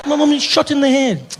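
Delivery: loud, high incredulous retort; hammed-up exasperation; indignant protest. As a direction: protest loudly at a tactless fan. Indignant reply to intrusive fan.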